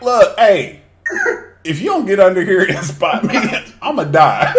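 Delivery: loud, high, laughing plea; retold urgent demand breaking into laughs; desperate mock warning. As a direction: call for help as the weight wins. Acts out desperate plea for a spotter.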